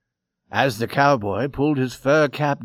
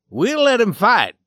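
raised, firm, loud resolve; brave declaration; 9 semitones higher, 5 dB louder than usual. A narrator's reading. Old man answering the cowboy, brave declaration. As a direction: announce the decision boldly and high. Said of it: "he answered bravely".

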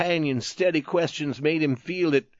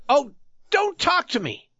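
much higher, sharp impatient outburst; impatient cry; 12 semitones higher than usual. A narrator's reading. Youth to the tattered man, impatient high cry. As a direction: snap it out, fed up. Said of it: "gave a cry of impatience".

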